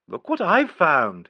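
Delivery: slow, high coaxing simper. A narrator's reading. Nigel tempting the child with a coin, simpering. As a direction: coax the child with a sugary sweetness. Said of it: "he simpered".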